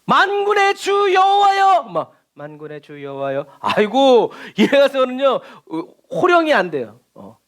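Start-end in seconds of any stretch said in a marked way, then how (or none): 0.0-1.8 high, drawn-out ringing proclamation; booming scripture shout, stretched out; loud reading. Shown as a demonstration. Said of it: "큰 소리로 읽었어요". 2.3-3.5 low, soft, deflated recitation; quiet, lowered voice; subdued reading. Shown as a demonstration. Weak take against the loud one.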